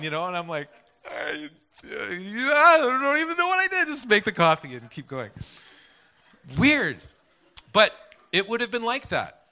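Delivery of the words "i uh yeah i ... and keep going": hesitant start, then high, loud blurt; stammer, then squeal out an excuse; acted-out embarrassed fluster; flustered panic. Acting out his own panicked reply.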